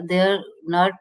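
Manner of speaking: ordinary talk, nothing marked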